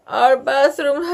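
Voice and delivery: high, tearful wail; child wailing to her teacher. A narrator's reading. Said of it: "she wailed suddenly".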